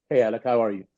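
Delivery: put-on a light London accent, pitched low. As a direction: greet him in a hint of a London accent. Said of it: "London accent".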